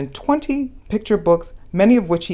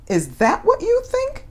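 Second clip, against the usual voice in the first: teasing question. Acted. Teasing question to young listeners.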